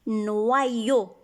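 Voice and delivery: "Noyo" said nwa-yo, with a wa glide; correct reading. Shown as a demonstration. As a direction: sound out "Noyo" carefully as nwa-yo. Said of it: "Les lettres O I font le son wa".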